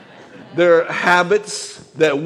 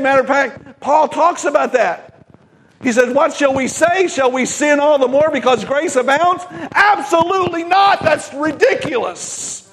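preaching at full force; 6 semitones higher than usual. Acted. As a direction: drive the message home to the congregation.

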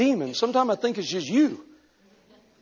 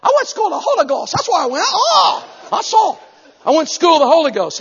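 high, loud, fast fervent shout; shouting preacher; 11 semitones higher, 8 dB louder than usual. Retold or imitated. Preacher retelling his reply to the Harvard man, high fast shout. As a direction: fire it back at him.

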